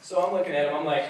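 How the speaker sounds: normal talking voice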